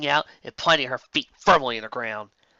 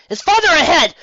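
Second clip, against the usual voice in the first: much higher, loud panted call; panting; 11 dB louder than usual. A narrator's reading. Twilight to Megan while running, loud and panting. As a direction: gasp it out while galloping. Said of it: "she panted between breaths".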